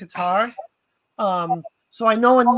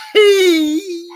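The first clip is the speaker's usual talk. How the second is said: sudden high, louder "Pee"; random squeaky "Pee"; 7 semitones higher, 6 dB louder than usual. Retold or imitated. Teasing her focused partner with a silly squeak. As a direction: blurt "Pee" to startle him. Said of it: "And I'll go".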